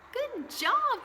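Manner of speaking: a big, high-pitched "good job"; high praise. Real, not acted. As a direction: reward them with a bright lift. Teacher praising the class.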